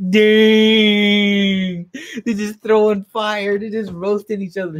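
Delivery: high, drawn-out hype holler; thrilled spectator of a roast; hyped reaction. Real, not acted. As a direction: holler it high, then keep the pitch up. Reacting to insults in the chapter.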